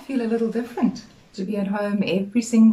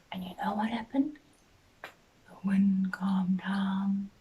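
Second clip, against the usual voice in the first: hushed storytelling; 3 semitones lower, 9 dB quieter than usual. A narrator's reading. Teacher telling children the storm stopped, whispered. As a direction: draw the children in with a hush.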